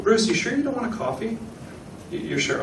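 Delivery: high, soft polite check; lightly acted, high-pitched coaxing; gently insistent host. Shown as a demonstration. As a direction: play a host softly double-checking an offer. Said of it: "The reason I'm asking three times".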